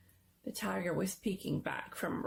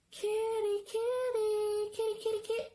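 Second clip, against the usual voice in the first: eerie singsong call. A narrator's reading. Unseen voice luring the boy around the corner, eerie high singsong. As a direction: lure him in sweetly, high and thin.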